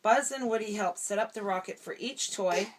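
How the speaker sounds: ordinary delivery, nothing marked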